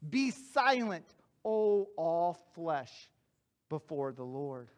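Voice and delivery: solemn hush before the Lord; slow solemn reading. Shown as a demonstration. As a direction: let each phrase fall away into near silence. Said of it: "The Bible says in Zechariah".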